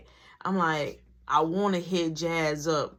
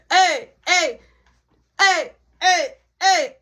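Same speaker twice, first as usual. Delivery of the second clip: loud, high, repeated party holler; tipsy mom; 7 semitones higher, 7 dB louder, slower than usual. Retold or imitated. Mom comes home lit and calls out, tipsy. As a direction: imitate a lit mother hollering for attention. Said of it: "My mom lit".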